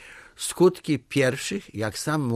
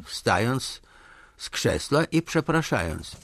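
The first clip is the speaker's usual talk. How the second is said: usual speaking voice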